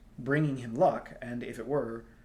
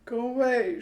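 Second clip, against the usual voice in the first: high, louder sobbing plea; sobbed; 12 semitones higher, 9 dB louder than usual. A narrator's reading. Star girl sobbing at Tristan to leave. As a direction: sob it out, face hidden. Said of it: "she sobbed".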